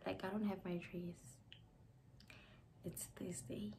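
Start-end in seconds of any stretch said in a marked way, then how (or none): 2.8-3.7 whisper. Real, not acted. Bride confiding the dress was left behind, whispering.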